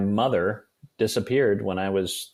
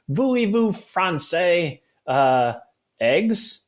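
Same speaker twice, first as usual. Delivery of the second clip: mock French; 7 semitones higher, 4 dB louder, much slower than usual. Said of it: "But I don't know any French".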